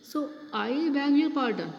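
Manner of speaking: indignant emphasis on the word "I"; stress on I. Shown as a demonstration. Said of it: "pay stress on the first word".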